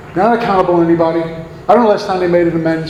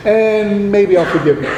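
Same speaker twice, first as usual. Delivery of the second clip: slow, lightly put-on Moses voice; a hint of a stern prophet, drawn out; 3 semitones higher than usual. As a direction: preach it slowly like a mock Moses. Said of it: "I'll come with that deep Moses voice".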